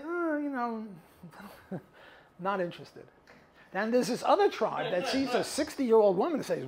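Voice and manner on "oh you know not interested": mock indifference. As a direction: shrug it off with a little laugh. Acted tribe unimpressed by older woman.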